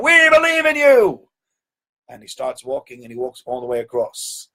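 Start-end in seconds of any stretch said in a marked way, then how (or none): 0.0-1.3 high, loud cheering crowd. Retold or imitated. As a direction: cheer him on as the eager crowd. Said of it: "they're going".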